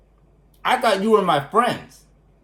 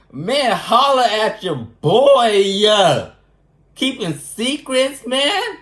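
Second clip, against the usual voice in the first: mock outcry; 3 semitones higher, 4 dB louder, much slower than usual. Calling out friends for secrets.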